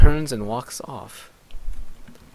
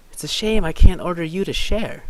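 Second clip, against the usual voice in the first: taunting voice; 6 semitones higher, 5 dB louder than usual. Acted. Taunting character voice.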